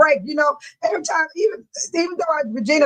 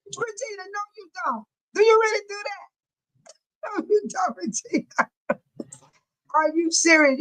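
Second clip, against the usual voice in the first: laughing disbelief; 6 semitones higher than usual. Real, not acted. Amazed at friend's chat comment.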